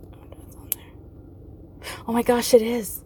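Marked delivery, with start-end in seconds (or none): 0.1-0.8 whispered muttering to himself. Real, not acted. Whispering while looking up the video.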